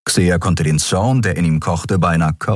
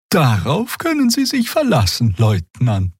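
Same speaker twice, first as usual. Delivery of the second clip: friendly assurance; 8 semitones higher than usual. A narrator's reading. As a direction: reassure her brightly. Xia continues to guard.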